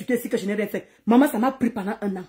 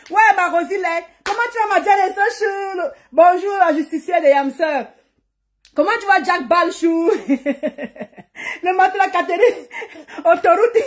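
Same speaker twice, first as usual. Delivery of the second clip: laughing greetings; 6 semitones higher than usual. Real, not acted. Greeting viewers while still laughing.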